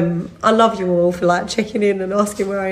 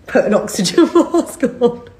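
laughing retold order; 6 semitones higher than usual.

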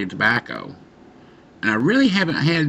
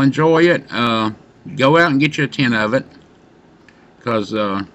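speaking around a pipe stem; words between pipe puffs; talking with pipe in mouth. Real, not acted. Seen 1: Pipe stem held at or in mouth while talking; briefly removed at 9.2.

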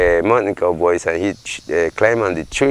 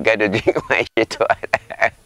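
words breaking into a laugh; 3 semitones higher than usual. Real, not acted. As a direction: crack up while telling the punchline. Storyteller laughs at crude punchline.

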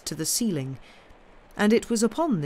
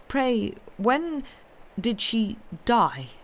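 slow, halting higher question; said heavily; 5 semitones higher, slower than usual. A narrator's reading. Mister Jarvie asking about her death, slow heavy question. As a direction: weigh each word, hesitating. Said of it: "said Mister Jarvie heavily".

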